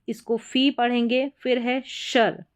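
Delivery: usual speaking voice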